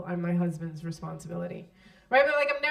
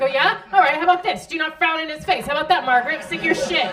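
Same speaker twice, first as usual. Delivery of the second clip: much louder and higher, mock-furious husband; angry husband; 7 semitones higher, 10 dB louder than usual. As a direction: snap it like a guy fresh from a fight with his wife. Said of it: "He's like".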